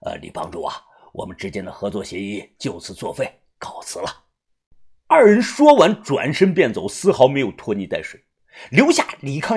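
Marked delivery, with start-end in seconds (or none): 0.0-4.2 much lower and softer, begging; bow and plead in a small voice; cowering old man. Acted. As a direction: fat old fighter humbly begging for mercy. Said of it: "立即转头冲向李康阳说".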